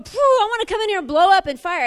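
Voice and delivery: loud, fired-up burst; fiery outburst. Real, not acted. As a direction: let it blow up like fire. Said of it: "I'm wanting to go".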